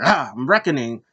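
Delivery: lightly hammed-up wrestler's announcement; loud mock cry opening the line. Mimicking a masked wrestler announcing herself, mock dramatic.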